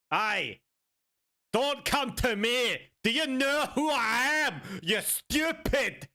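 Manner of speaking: high, drawn-out mock-Scottish threat; bad Scottish accent. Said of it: "I know my Scottish accent isn't too good".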